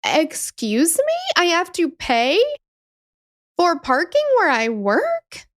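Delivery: slow, high, disbelieving quote; mock outrage at being asked to pay for her own parking; incredulous. Imitating herself at work, incredulous and slow. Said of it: "I was like".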